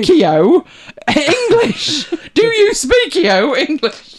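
slow, loud, high speakio with a laugh; mock tourist Spanish. Host mocks Brits adding o to words, slow and loud. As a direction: stretch the fake o on speakio. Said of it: "adding O to every noun, that's another trick".

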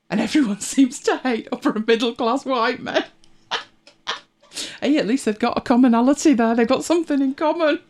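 laughing. Real, not acted. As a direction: say it while breaking into laughter. Amused at who gets hated.

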